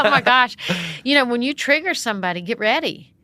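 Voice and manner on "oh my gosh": shocked. Retold or imitated. Shocked after being confronted.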